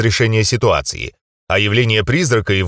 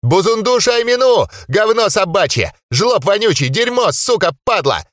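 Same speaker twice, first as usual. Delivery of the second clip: cursing rant; 9 semitones higher than usual. Acted. Gnome hurls curses.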